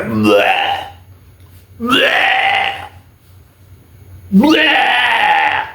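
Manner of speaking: gag it out like throwing up; retching sounds. Retold or imitated. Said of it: "he like".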